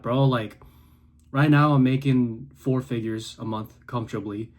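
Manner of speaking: ordinary talk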